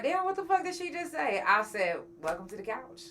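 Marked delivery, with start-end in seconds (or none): 0.0-1.4 much higher, stunned disbelief; mock shocked reaction; shocked listener. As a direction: play a listener gaping at what she said. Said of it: "y'all gonna be like".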